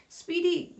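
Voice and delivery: calls the name out high and loud; anxious call into the radio. Acted. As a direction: shout the name across to him, worried. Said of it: "Greg called out anxiously".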